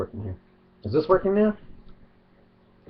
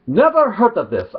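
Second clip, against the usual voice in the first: mock Arabic accent; 8 semitones higher than usual. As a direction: quote it in a hammed-up Arabic accent. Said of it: "mock Arabic accent".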